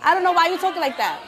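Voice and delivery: loud, high furious rant; angry yelling. Real, not acted. Teen furiously yells at audience mother. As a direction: tear into the heckler.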